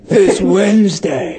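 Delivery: slow mock trailer voice; hammed-up ominous announcement; sinister announcer. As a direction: draw it out like a film promo. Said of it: "really sinister".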